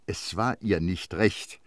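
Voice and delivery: plain reading voice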